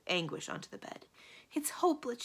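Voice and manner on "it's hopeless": high-pitched wail of hopelessness; despairing cry. A narrator's reading. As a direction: cry out as if all is lost. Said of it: "she cried".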